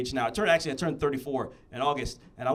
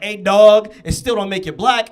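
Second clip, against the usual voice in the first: mock loud retort; 3 semitones higher, 11 dB louder than usual. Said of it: "he was like".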